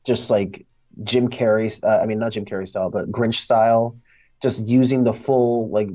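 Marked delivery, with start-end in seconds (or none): none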